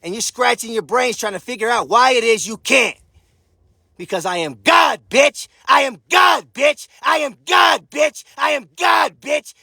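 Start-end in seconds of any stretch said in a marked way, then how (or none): 4.0-9.5 repeated shouted boast. Real, not acted. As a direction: hammer the boast home like a chant.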